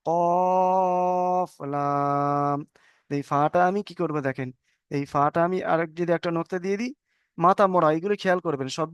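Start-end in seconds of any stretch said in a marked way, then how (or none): 0.1-2.6 very slow recitation of letter names; drawn-out letter recitation. Shown as a demonstration. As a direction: stretch each letter name long and sung. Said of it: "এই দেখেন".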